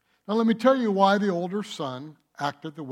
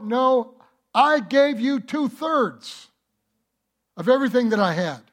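loud, higher, insistent father; the father answering; 7 semitones higher, 6 dB louder than usual. Retold or imitated. Preacher voicing the father answering his older son loudly. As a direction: hit it hard as the father.

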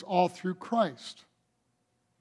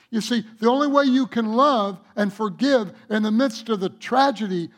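louder emphatic preaching voice; preaching with force; 2 semitones higher, 4 dB louder than usual. Real, not acted. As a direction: drive the point home to the congregation. Preaching builds.

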